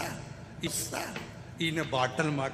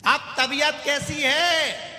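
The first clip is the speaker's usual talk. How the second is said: loud shouted question; 12 semitones higher, 6 dB louder, slower than usual. Retold or imitated. Deaf visitor shouts at patient.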